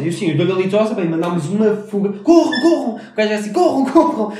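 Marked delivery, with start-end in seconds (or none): 2.2-2.9 higher, louder panicked yell; shouting like a madman. Retold or imitated. Friend retelling a guy screaming at them to run, loud yell. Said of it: "Tipo, feito maluco". 3.5-4.3 higher, louder panicked yell; shouting like a madman. Retold or imitated. Friend retelling a guy screaming at them to run, loud yell. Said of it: "Tipo, feito maluco".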